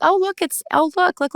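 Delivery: very high, delighted look look; excited pointing. Retold or imitated. She spots the frog on the hike, high excited squeal. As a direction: squeal it like spotting something thrilling.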